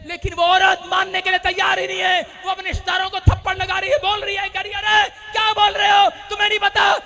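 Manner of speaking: loud, high revival preacher shout; rousing faith proclamation, building to a long held finish; full-force preaching. Real, not acted. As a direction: drive each declaration home to the crowd.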